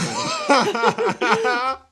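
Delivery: real burst of high, loud laughter. Real, not acted. Both laugh at his punchline.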